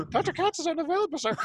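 high, fast secretary voice; prim receptionist. Retold or imitated. Receptionist turning the caller away, high prim reply. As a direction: brush him off like a snooty receptionist. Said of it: "He's like".

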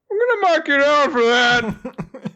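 high, slow mock scolding teacher; a high prissy teacher's warning. Teacher scolding a student, high and slow. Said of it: "the teacher's like".

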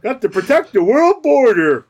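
high booming strongman voice; hammed-up strongman announcing; strong man voice. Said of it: "the Goofy and the Strong Man".

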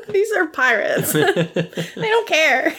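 high-pitched, incredulous laughing protest; laughing exasperation. Retold or imitated. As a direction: scold through helpless laughter. Exasperated response to captain, retold.

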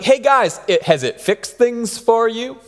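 ribbing the tired fishermen with a grin; teasing call. Retold or imitated. Jesus teases the frustrated fishermen from the shore, playfully. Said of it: "Jesus is like".

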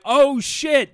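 high, loud re-enacted yell; "oh shit" yell. Driver about to hit a tree, re-enacted yell. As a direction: holler it like a crash is coming.

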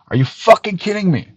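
normal talking voice

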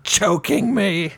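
catchphrase impression. Fan teasing a girl with a wrestler's catchphrase, drawn-out mock voice. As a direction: stretch it out like a hammed-up wrestling catchphrase. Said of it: "I also all the time tell her".

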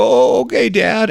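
high-pitched little son giving in; obedient child. Acted. Son answering his consoling father, obedient child voice. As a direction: agree like a small boy to his dad. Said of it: "And you go".

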